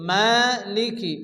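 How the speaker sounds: মালিকি slowly with ma held one count; stretched ma. Shown as a demonstration. Teacher demonstrating the stretch in মালিকি. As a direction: draw out the ma in মালিকি. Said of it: "এক আলিফ পরিমাণ টান দিয়ে পড়তে হবে".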